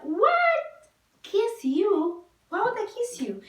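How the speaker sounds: high, loud, incredulous outburst; shocked disbelief. Retold or imitated. Woman retelling her reply to a friend's demand for a kiss, shocked.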